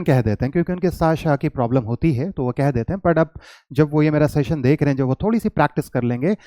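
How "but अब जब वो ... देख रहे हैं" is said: ordinary talk, nothing marked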